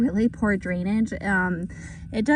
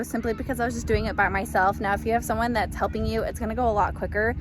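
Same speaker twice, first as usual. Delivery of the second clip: ordinary talk, nothing marked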